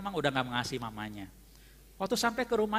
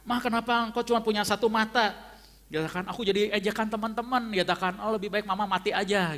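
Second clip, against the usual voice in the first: higher, imitating a resentful child lashing out; angry son's outburst; 7 semitones higher, 4 dB louder than usual. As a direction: retell the boy shouting at his mother, half-acted. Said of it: "Ya, marah sekali sama mamanya".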